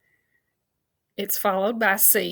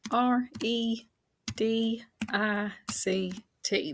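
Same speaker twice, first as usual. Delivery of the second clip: slow letter-by-letter spelling; one letter at a time, unhurried; spelling aloud; 3 dB quieter, much slower than usual. Shown as a demonstration. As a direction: spell it out slowly, leaving a gap between letters. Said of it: "let's spell it".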